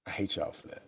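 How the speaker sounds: unvoiced playful whisper; whispered tease. Real, not acted. Teasing the viewers waiting for drama, in a playful whisper.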